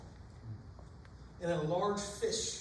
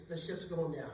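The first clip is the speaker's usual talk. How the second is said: usual speaking voice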